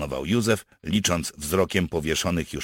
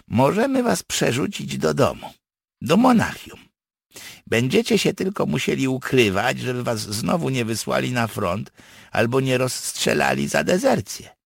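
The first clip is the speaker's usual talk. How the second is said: high, animated character voice; partisan making an offer; 9 semitones higher, 5 dB louder than usual. Acted. Partisan offers to get captives home, explaining. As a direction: set out the plan, pitched up.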